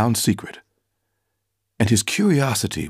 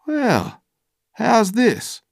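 high, amazed exclamation; stunned into a slow question; 14 semitones higher, much slower than usual. Acted. Carpenter stunned by the slave's claim, high and amazed. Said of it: "gazed at me a long time".

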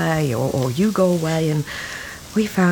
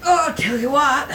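calling out; 6 semitones higher than usual. Acted. Neighbour hauling heavy bag.

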